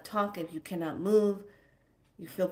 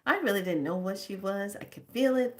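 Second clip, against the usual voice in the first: ordinary talk, nothing marked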